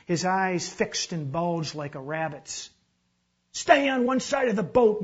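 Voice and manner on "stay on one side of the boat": high, loud yell across the boat; yelled warning. A narrator's reading. Maurice yells at the onlooker in the boat, urgent shout. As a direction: yell an order over the rapids. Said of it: "Maurice had yelled at him".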